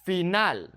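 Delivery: Final as fee-NAHL; Spanish "Final" with ee and open a; Spanish pronunciation. Shown as a demonstration. Teacher models the word, Spanish Final. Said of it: "focusing on the vowel sounds".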